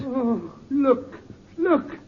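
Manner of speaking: very high, frightened cries; shocked horror. Acted. Servant horrified at the corpse, crying out. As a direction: recoil in horror as you point. Servant sees the body change.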